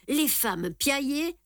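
usual speaking voice

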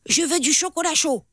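put-on high, demanding child's voice; a spoiled little goat kid asking. A narrator's reading. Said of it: "Il dit".

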